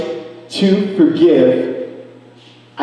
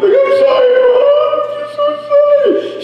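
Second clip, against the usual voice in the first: much higher, pleading through tears; reliving a crying apology to mom, pitched way up; tearful apology. Retold or imitated. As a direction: voice breaking as she says sorry. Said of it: "And I started crying".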